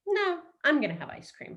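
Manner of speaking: high, dismissive selfish voice; mock selfish brat. Coach playing a selfish person choosing ice cream, high and mock. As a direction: play the self-centred one lightly.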